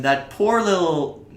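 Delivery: ordinary talk, nothing marked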